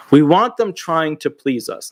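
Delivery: usual speaking voice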